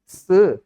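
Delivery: SE, short and loud, as Chinese four; Chinese pronunciation of four. Shown as a demonstration. Preacher checks how four is read, SE in loud Chinese pronunciation. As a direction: punch out SE as a Chinese number. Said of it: "Empat yang benar itu bacanya gimana".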